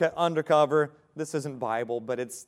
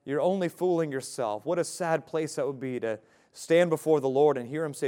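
ordinary talk, nothing marked

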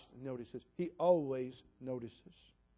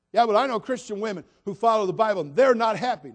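loud, raised-pitch imitation of an objector; mock objection; 5 semitones higher, 11 dB louder than usual. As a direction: throw it out loudly as someone else's protest. Voicing an objector before correcting them.

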